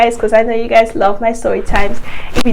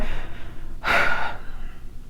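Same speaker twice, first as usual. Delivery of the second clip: tired breathy sigh; long sigh; 9 dB quieter than usual. Real, not acted. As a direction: let out a long weary sigh. Exhausted after the story.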